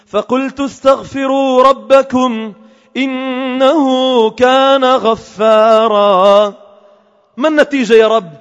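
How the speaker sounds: slow melodic chanting of the verse; Quran recitation. Acted. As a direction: chant the verse slowly with tajweed. Quran recitation within sermon. (تلاوة قرآنية مرتلة ببطء)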